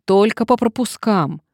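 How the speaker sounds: whining. A narrator's reading. Reluctant man citing hospital rules, whining. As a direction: add another whiny excuse. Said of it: "ныл он".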